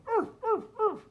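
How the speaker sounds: high yappy arf arf from a little dog; little dog barking. Acted. Reading to a child, playing the little dog, loud high barks. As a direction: bark it out like a small puppy, high and loud. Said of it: "Another little dog".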